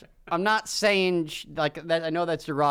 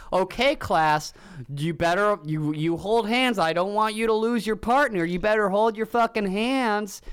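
kindergarten teacher; 6 semitones higher, 3 dB louder than usual. Retold or imitated. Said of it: "kindergarten teacher was like".

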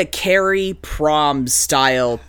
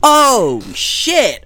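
shocked; 10 semitones higher than usual. Real, not acted. Shocked reaction to dead drivers.

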